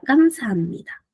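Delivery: 감사합니다 said fast, with the syllables blended; fluid pronunciation. Shown as a demonstration. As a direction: run 감사합니다 together quickly and smoothly. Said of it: "para que suene mejor, más fluido". (감사합니다 rápido y fluido, sílabas unidas)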